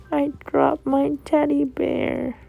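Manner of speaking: put-on whiny little-boy voice, high and slow; mock tearful wail of a small child; crying child. Crying son telling mommy about his teddy, high whine. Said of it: "when Elwood started crying".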